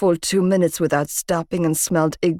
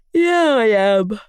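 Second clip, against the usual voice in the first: yawning; 4 semitones higher, slower than usual. A narrator's reading. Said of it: "he replied, yawning".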